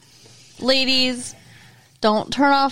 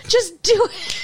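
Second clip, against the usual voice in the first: urging the screen; 10 semitones higher than usual. Real, not acted. Urging TV characters again.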